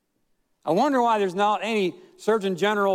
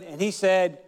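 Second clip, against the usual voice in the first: normal talking voice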